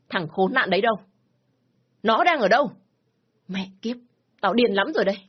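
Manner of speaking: loud furious questions; raging demand. Acted. Father demanding where the daughter's lover is, raging.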